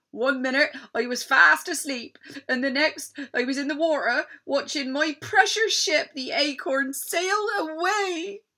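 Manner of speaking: a pirate pouring out his woe; upset pirate. Acted. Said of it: "he sobbed".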